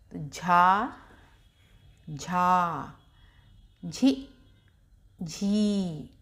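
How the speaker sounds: slow syllable-by-syllable recitation; teacher reciting each syllable with a pause; barakhadi drill. Shown as a demonstration. Teacher drilling children on चा row, recited slowly. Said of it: "ऐसे ही झा का उच्चारण होता है".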